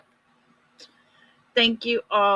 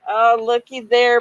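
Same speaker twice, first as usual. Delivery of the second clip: ordinary talk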